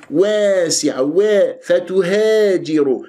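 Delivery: واسعة and فتهاجر with aa bent towards e; wrong tilted alif. Shown as a demonstration. Teacher mimics mistake of tilting alifs in واسعة, fronted vowels. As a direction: spread the lips, making "wesi'a, fatuhejiru". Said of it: "أنت أملت الألفات".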